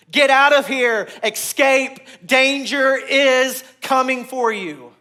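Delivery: loud, high, slow alarm call; urgent warning. Acted. Preacher voicing a warning to flee danger, loud and urgent. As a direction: shout it like a wave is about to hit.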